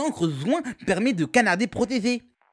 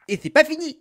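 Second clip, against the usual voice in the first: high, loud catchphrase burst; excited announcement; 8 semitones higher, 5 dB louder than usual. Acted. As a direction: announce eagerly that there's more. Catchphrase announcing yet another weapon. (annonce enthousiaste, aiguë et forte)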